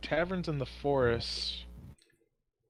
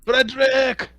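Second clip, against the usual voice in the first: loud, high shout for a servant; calling out; 10 semitones higher, 11 dB louder, slower than usual. Acted. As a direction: holler across the tavern. Tavern keeper summons the bouncer.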